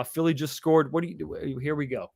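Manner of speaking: usual speaking voice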